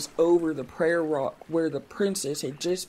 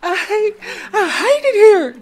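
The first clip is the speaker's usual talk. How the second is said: much higher, louder shrieking; 12 dB louder than usual. Acted. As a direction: scream it in fury. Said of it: "she shrieked".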